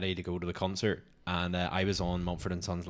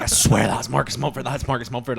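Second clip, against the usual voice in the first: higher, louder, urgent insistence; retold freaking out; 5 semitones higher, 7 dB louder than usual. Insisting to partner she has spotted [name], freaking out. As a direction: swear it, frantic to be believed. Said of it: "I started freaking out".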